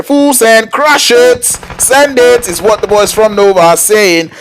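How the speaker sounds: high-pitched, urgent esports fight call; excited play-by-play. Real, not acted.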